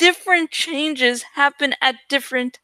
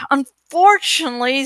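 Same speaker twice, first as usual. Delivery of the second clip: plain reading voice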